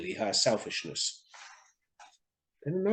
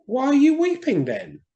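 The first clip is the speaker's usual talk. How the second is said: higher, louder bird voice for the swallow; swallow's voice; 14 semitones higher, 9 dB louder than usual. Acted. Swallow explaining to the reed, higher and louder. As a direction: voice the swallow up high.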